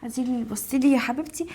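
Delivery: soft, low, mocking taunt; spiteful gloating. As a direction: twist the knife quietly. Wife gloats to her rival friend. (شماتة بصوت منخفض)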